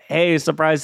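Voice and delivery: normal talking voice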